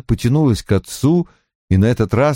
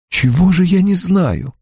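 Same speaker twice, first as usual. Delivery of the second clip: higher-pitched, alarmed daughter; frightened question; 8 semitones higher than usual. A narrator's reading. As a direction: ask anxiously, pitch raised. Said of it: "испуганно спросила Анна". (испуганный вопрос высоким голосом)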